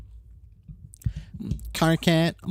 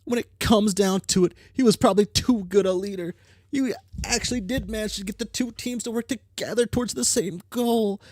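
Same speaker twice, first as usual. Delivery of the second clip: put-on bright, higher girl character read; troll girl character voice; 11 semitones higher than usual. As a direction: voice the troll with cocky energy. Reads game character lines.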